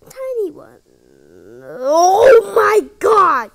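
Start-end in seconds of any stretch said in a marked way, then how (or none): 1.6-3.5 sudden frightened yell; frightened scream. Real, not acted. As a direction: scream in shock. Sudden jump scare in video.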